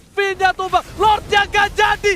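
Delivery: high, loud hype call; excited shout. Real, not acted. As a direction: yell the play as it happens. Caster calling a kill live.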